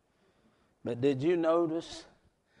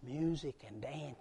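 hushed; 13 dB quieter than usual. Acted. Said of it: "That's what it said".

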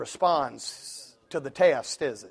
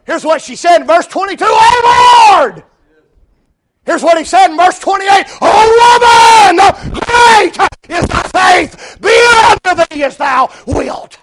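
full-force preaching; 12 semitones higher, 14 dB louder than usual. Acted. Preacher proclaiming the woman's and Jesus's words, full-force shouting. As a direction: shout the verse with long held words.

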